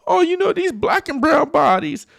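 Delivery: high, rising mock-earnest phrase; mocking high preachy voice. Mocking activists who preach the slogan, lifted high. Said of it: "That's the whole thing".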